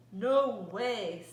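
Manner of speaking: loud, firm farmer's no; booming angry refusal. Acted. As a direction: shout it down flat. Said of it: "said Farmer Brown".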